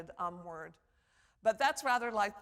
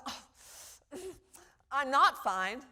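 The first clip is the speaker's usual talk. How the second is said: demonstrated discomfort; 4 semitones higher, 5 dB louder, much slower than usual. Shown as a demonstration. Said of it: "If I pause and, ... I'm not fine".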